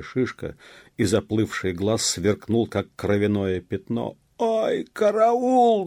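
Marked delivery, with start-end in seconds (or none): 4.4-5.9 very high, loud, drawn-out yell; cry for help. A narrator's reading. Beaten officer fleeing, screaming for help. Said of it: "закричал он".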